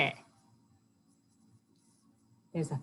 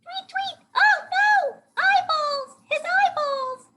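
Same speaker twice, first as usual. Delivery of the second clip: very high, loud squealing bird; chirp, then shriek in slow falsetto alarm; hammed-up squeaky bird panic; horrified bird; 7 dB louder, slower than usual. A narrator's reading. Bird character horrified in story.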